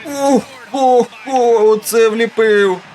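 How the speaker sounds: wowed by the slam; excited exclaiming. Real, not acted. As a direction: savour the dunk, stretching every word. Reacting to a dunk.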